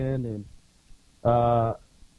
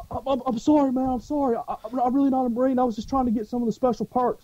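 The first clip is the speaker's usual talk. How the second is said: high, stammering mock pleading; imitated panicky apology; 10 semitones higher, faster than usual. Caught poser apologizes to an angry Marine, mock pleading. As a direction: imitate someone caught out and begging off.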